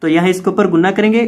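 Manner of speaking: normal talking voice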